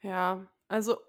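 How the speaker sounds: ordinary talk, nothing marked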